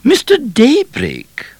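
high, slow regal greeting; stately royal welcome; Queen Victoria. A narrator's reading. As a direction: greet him like a gracious queen. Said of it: "said the Queen".

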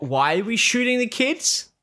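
high, rising disbelief; incredulous. Retold or imitated. Viewer questioning the general's order to shoot kids, incredulous. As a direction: voice climbs into a baffled question.

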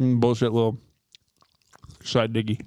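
talking while eating; words round a mouthful; mouth full. Real, not acted. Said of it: "we sound like shit right now eating".

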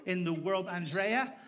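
normal talking voice

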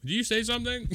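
high, questioning call from afar; lightly voiced wife calling down; wife calling from upstairs. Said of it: "and she'll be like".

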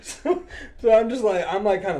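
loud, high, through real laughter; laughing while talking. Real, not acted. Storyteller cracking up over the burning-house call, laughing. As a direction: start the sentence still cracking up.

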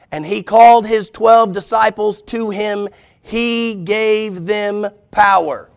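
slow and loud, each word weighed; slow emphatic reread. Shown as a demonstration. Preacher rereading the verse slowly for the church. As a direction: read it slowly so it sinks in. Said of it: "read it again".

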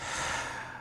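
long breathy exhale before confessing; imitated heavy sigh. Partner readies herself to announce pregnancy, imitated heavy sigh. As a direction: let out a soft, bracing sigh.

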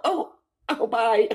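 high excited squeal of a fan; starstruck squeal. Retold or imitated. Jackie flustered saying goodbye to her idol, squealing. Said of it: "She'll just be like".

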